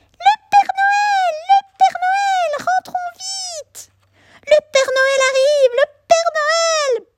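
very high, tiny squeaking mice; squeaky mouse voices. A narrator's reading. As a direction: squeak like excited little mice. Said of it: "piaillent plein de petites voix".

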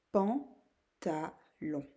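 "pantalon" very slow, three separate beats; syllable by syllable. Shown as a demonstration. Teacher naming the first picture, syllable by syllable. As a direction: break "pantalon" into pan, ta, lon. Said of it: "taper dans les mains pour vous aider".

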